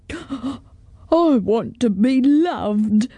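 gasp, then a slow, drawn-out sob; hammed-up tearful dragon wail; sobbing dragon. A narrator's reading. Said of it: "sobbed the dragon".

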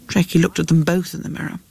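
usual speaking voice

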